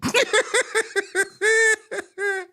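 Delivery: high, loud, drawn-out laugh. Real, not acted. Host laughs at guest's scolding over her name, laughing.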